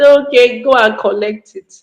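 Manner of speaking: a loud, high okay that then settles; a parent caving in to stop the crying; giving in to child. Retold or imitated. Parent gives in to the crying child, mildly loud and resigned.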